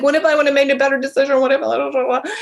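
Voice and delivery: fast and loud, anxious rambling; mock fretful what-ifs. Mocking people stuck on past decisions, fast and anxious. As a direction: spiral into panicked second-guessing. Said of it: "in that screwed up, like".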